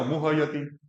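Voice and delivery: मुह्यति as four syllables "mu-ha-ya-ti"; full ha, mispronounced. Shown as a demonstration. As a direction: voice ha separately in मुह्यति. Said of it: "अशुद्ध क्या हो जाएगा".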